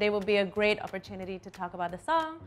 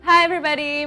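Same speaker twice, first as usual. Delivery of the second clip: show host greeting; 6 semitones higher, 12 dB louder, faster than usual. Acted.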